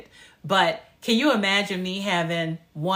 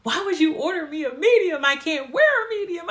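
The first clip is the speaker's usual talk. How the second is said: high, wailing, hammed-up despair; complaining to her mom about the skirt size, with a hammed-up wail; mock meltdown wail; 10 semitones higher than usual. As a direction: whine it like a toddler in a tantrum. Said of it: "I'm having a meltdown".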